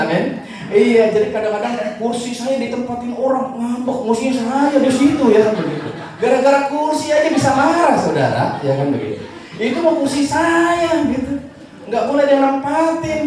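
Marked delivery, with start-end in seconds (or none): 0.7-7.9 high, loud laughing tease; laughing mockery. Preacher teasing churchgoers who sulk over seats, laughing. 9.6-13.2 sulky churchgoer. Retold or imitated. Churchgoer claiming a seat, hammed-up whiny.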